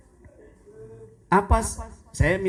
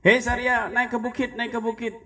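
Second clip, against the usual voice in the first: retold shout; 8 semitones higher than usual. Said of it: "beliau teriak".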